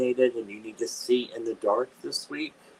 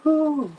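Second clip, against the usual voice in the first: high exhale of relief; a long relieved blow; relieved whew; 7 dB louder than usual. Real, not acted. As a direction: let the fright go in one breath. Relief at end.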